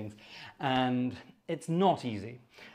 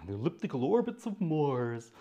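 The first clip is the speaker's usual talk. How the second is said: Carl Sagan; 3 dB quieter than usual. Acted. Host mimicking Carl Sagan on planetary orbits. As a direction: savour the words like an imitated astronomer. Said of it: "that was Carl Sagan, wasn't it".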